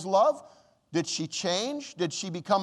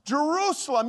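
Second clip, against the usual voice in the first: the second Jerusalem higher and fuller, a cry of longing; rising lament; 7 semitones higher than usual. Retold or imitated. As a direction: call the city again, more urgently. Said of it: "crying and saying".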